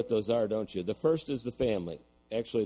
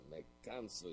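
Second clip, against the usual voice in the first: grumbling under breath; 14 dB quieter than usual. Retold or imitated. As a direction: grumble it softly to yourself. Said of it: "he was grumbling under his breath".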